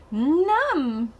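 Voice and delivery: imitating Autumn's num. Mother echoing Autumn eating a homemade popsicle, playful loud imitation. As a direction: mimic an eater's happy num. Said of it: "she just said".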